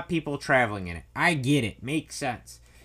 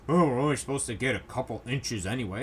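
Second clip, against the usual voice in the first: lightly put-on naysayer voice, lower; a hint of a dopey dismissive grumbler; mocked dismissive guy; 4 semitones lower than usual. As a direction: imitate a scoffing guy, pitched down. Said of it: "guys like".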